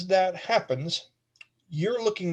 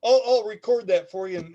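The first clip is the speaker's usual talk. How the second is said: ordinary delivery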